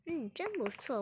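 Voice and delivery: ordinary delivery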